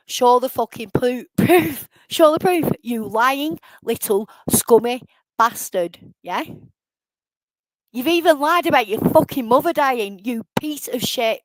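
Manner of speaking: mad. Real, not acted. Streamer challenging Cliffy to show proof, angry. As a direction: demand it hotly, hammering the insults. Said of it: "I've got mad. I am mad".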